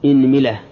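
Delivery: "inmila", opening on i, i again after m; kasra on both. Shown as a demonstration. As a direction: say إنملة as "inmila", i after the hamza and the m. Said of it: "كسر الهمزة". (إنملة بكسر الهمزة وكسر الميم)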